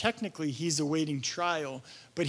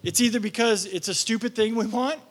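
ordinary talk, nothing marked

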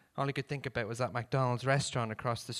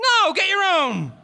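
shouting; 11 dB louder than usual. Acted. Shouted refusal.

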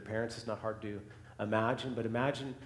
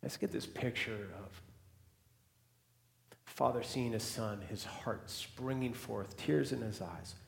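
hushed storytelling; 2 semitones lower, 8 dB quieter than usual. Acted. Preacher drawing listeners into the scene, hushed. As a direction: paint the reunion in a near-whisper. Intimate quiet retelling of the embrace.

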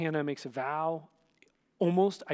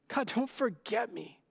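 desperate plea; 5 semitones higher than usual. Acted.

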